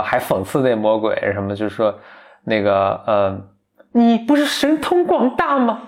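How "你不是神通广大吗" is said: mocking taunt. Girl taunts the demon.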